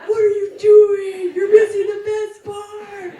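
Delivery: high, loud, slow hammed-up shout; mock shout. Bride imitating her husband scolding her mom. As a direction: complain loudly in mock outrage. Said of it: "he was like".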